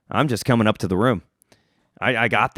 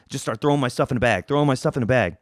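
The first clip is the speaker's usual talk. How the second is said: ordinary delivery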